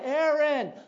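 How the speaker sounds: put-on loud, high calling for lost children; a frantic father calling his missing boys. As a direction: shout their names across the woods. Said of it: "Calling out".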